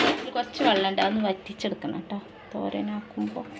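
ordinary talk, nothing marked

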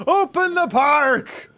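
loud, high drawn-out shout; playful booming proclamation, high and stretched; mock command. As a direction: shout it like a grand order, slowly. Calls out a command.

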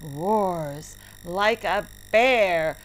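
usual speaking voice

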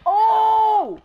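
sudden loud, high yelp of surprise; a real jolt of fright, shouted high; startled shout. Real, not acted. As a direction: jump at the scare and cry out. Sudden scare in horror game.

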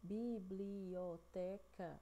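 biblioteca very slow, one syllable at a time; bi-bli-o-te-ca, each syllable held. Shown as a demonstration. Teacher counting five syllables, biblioteca very slowly split. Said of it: "cinco sílabas".

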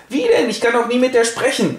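high-pitched whine; whiny lovesick prince.

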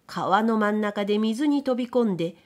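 plain reading voice

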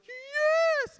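high, loud drawn-out yes; crowd's eager cheer. Acted. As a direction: shout it like a whole room answering. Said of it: "everybody goes".

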